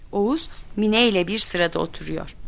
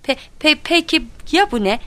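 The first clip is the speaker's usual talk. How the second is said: high, stammered half-shout; so cross the words get stuck; exasperated examiner tripping on syllables; angry stutter; 8 semitones higher, 4 dB louder than usual. A narrator's reading. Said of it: "kekelemeye başladı".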